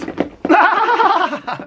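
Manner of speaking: high-pitched, mocking burst of laughter; mimicked laughing fit. Showing viewers how Alex laughs, mock cackle. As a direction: imitate someone cackling at a show. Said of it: "it'll be like this".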